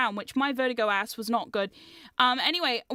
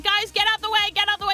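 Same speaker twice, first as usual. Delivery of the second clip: raised, higher mock panic cry; retold panicked scream; 7 semitones higher, 5 dB louder, faster than usual. Runner to friends while chased through maze, mock screaming. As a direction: yell it as if fleeing, lightly acted. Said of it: "I'm screaming and running".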